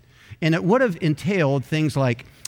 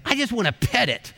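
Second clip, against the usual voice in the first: imitating his past self's wonder; childlike delight; 9 semitones higher than usual. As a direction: pitch it up like a smitten kid. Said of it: "but I said".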